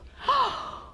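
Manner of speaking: a startled gasp, high; sharp gasp. Acted. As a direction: gasp in sudden alarm. Peddler finds caps missing.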